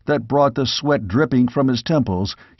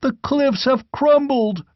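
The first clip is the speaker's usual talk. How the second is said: livid horrified cry; 13 semitones higher than usual. A narrator's reading. Squire reports the landslide to the king, high horrified cry. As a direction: cry out in disbelief. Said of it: "cried the livid squire".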